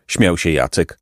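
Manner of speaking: ordinary delivery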